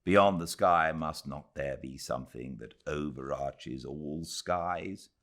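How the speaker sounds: plain reading voice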